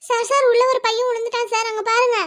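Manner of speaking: loud, high, breathless plea to the officer; urgent shout. Acted. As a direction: yell to make him look now. Urging the policeman to look. (அவசரமாக உரக்க கத்துதல்)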